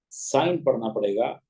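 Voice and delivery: usual speaking voice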